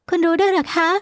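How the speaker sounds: high-pitched woman's question; surprised heroine. Acted. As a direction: ask in a high feminine voice, taken aback. (เสียงหญิงสาวสูง ถามอย่างแปลกใจ)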